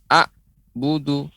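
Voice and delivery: "A'budu" with an abrupt glottal stop after "A"; wrong take of "A'budu", cut after the first vowel; cut-off ain. Shown as a demonstration. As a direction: say the ain like an alif, broken before "budu". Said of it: "a ini lebih dekat kepada huruf alif".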